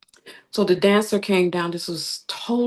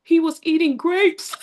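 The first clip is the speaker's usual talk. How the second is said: put-on child voice; 10 semitones higher, 3 dB louder than usual. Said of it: "She said".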